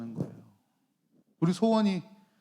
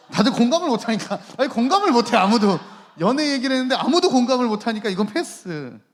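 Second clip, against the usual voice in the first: loud, higher playful complaint; raised-voice teasing rebuke; mock exasperation; 5 semitones higher, 10 dB louder than usual. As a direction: protest loudly that nobody gets you. Teasing rebuke to unresponsive young audience.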